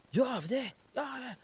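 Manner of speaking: high, lilting Vietnamese driver voice; mock Vietnamese accent.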